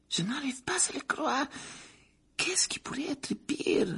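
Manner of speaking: high, breathy whisper of disbelief; hushed shock. A narrator's reading. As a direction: gasp it out, barely voiced.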